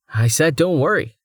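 ordinary delivery, nothing marked

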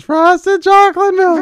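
high, loud, slow dopey drawl; dreamy cartoon dad craving milk; Homer Simpson voice. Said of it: "It's like Homer's".

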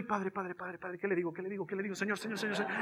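low, hushed, rapid repeated pleading; mock inner prayer. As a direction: murmur a panicked prayer under your breath. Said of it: "porque dentro mío estoy".

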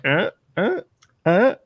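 tiny hesitant first words; Boyce's hesitant uhs. Retold or imitated. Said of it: "I kinda like mime Boyce".

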